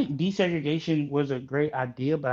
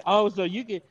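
laughing; 5 semitones higher than usual. Real, not acted. Laughing at friend dodging question.

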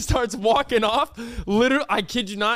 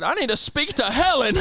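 imitated irate customer, pitched up; angry old man; 7 semitones higher than usual. Old customer demanding Helen at the counter, mock angry. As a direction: insist indignantly, as the old man. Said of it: "Why is he so angry?".

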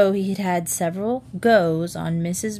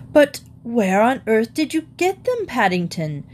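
aghast demand; 4 semitones higher, 3 dB louder than usual. Acted. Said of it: "voicing all their thoughts".

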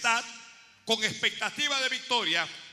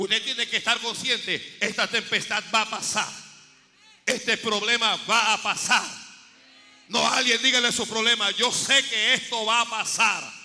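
loud, emphatic sermon cry; preaching at full force; 5 dB louder than usual. Acted. Preacher calling out to the congregation, loud and emphatic. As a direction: drive it home to the church, full voice.

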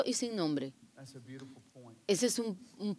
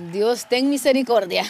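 louder cry of praise, chuckling after; fervent exclamation; 3 semitones higher, 7 dB louder than usual. Real, not acted. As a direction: exclaim it with emphatic force. Exclaims over a powerful sermon. (exclamación enfática)

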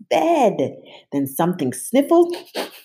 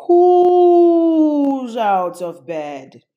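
very slow, louder, higher monster call; icy monster; 9 semitones higher, 7 dB louder, much slower than usual. A narrator's reading. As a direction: drag it out, icy.

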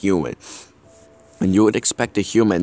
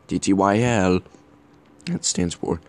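ordinary talk

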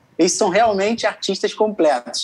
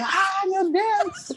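high, very slow mock ai meu Deus; mock overwhelmed gasp; 4 dB quieter, much slower than usual. Contestant mocking the crying winners, slow swooning oh my God. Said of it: "eu não fiquei".

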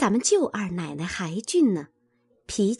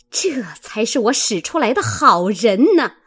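bitter sarcasm; 4 semitones higher, 6 dB louder than usual. A narrator's reading. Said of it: "骂道".

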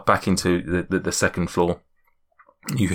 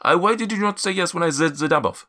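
higher, puzzled giant voice; Zephyros; 6 semitones higher than usual. Acted. Giant confused by guests' names, higher accented voice. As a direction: question them in a wandering accent. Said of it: "my accent's just all over the place".

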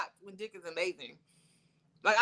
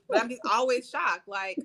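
usual speaking voice

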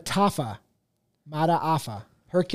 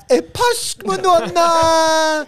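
put-on high, slow African chant-like accent; hammed-up Lion King African accent; 10 semitones higher, 4 dB louder, much slower than usual. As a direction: draw the name out high in a mock African accent.

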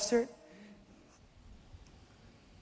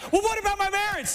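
high anxious whine; 9 semitones higher, faster than usual. Retold or imitated. Worried believer pleads with God.

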